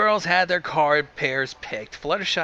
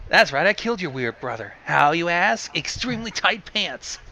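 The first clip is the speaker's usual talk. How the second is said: Chicago gangster voice; 3 semitones higher than usual. Acted. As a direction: talk like a Chicago mob boss. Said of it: "in her best Chicago gangster voice".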